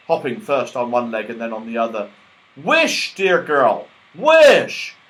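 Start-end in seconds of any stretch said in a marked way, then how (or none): 2.6-5.0 urgent excited. Acted. Moonface urging excitedly.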